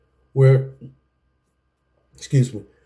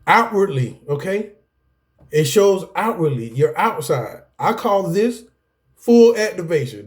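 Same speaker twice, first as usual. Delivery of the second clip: ordinary talk, nothing marked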